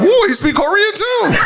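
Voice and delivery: high excited whoop and squeal; mock-impressed crowd. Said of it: "Y'all were like".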